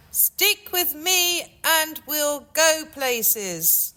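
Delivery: milk the punchline slowly. Acted. Boy comic delivers punchline.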